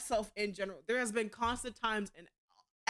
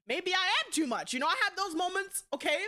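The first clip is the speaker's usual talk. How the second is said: ordinary delivery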